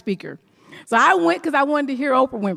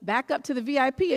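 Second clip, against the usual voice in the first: ordinary delivery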